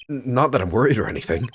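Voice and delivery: high, loud, stammering bravado; nervous loud denial. Acted. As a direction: protest too much, loudly. Nervous narration of first trial.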